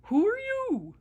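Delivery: bouncy dog asking a stranger; friendly dog voice. Acted. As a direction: ask it like a curious friendly dog. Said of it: "she barked".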